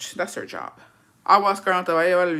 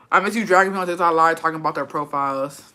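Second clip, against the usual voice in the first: usual speaking voice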